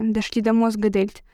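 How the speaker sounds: ordinary delivery, nothing marked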